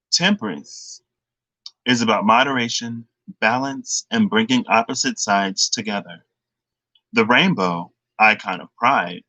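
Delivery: measured read, pausing between phrases; slowed-down reading. Real, not acted. Tarot reader rereading the Temperance card, slowed down. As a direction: take it slowly, phrase by phrase. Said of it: "I need to slow down".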